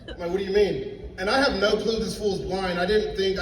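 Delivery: ordinary delivery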